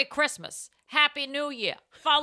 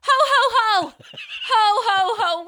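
high, loud jolly Santa laugh; 10 semitones higher, 9 dB louder, slower than usual. Acted. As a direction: belt out a hammy Santa greeting. Santa-style podcast opener.

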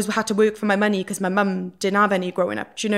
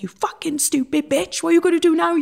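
mum yelling; 9 semitones higher than usual. Retold or imitated. Mum berating her daughter on the walk home, a furious yell. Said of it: "She was like".

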